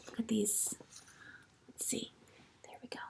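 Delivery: soft whisper; whispered aside. Real, not acted. Teacher whispering while turning pages. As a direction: drop to a hush.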